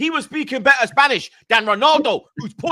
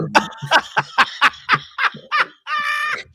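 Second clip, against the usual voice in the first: high howl of laughter; high shrieking laugh. Real, not acted.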